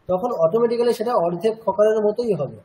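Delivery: ordinary delivery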